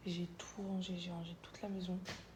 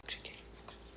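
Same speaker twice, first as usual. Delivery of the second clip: whispering; 9 dB quieter, much faster than usual. Real, not acted. Everyone asleep, sick voice.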